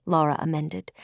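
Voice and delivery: ordinary delivery, nothing marked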